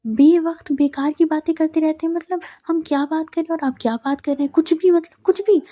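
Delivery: exasperated rant. Retold or imitated. Ayza exasperated at Bilal's teasing.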